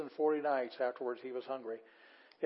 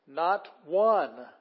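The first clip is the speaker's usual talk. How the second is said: "one" loud and stretched; emphatic NOT ONE; 3 semitones higher, 8 dB louder than usual. Shown as a demonstration. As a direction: punch each word, "Not", then a bigger "one". Driving home the literal meaning.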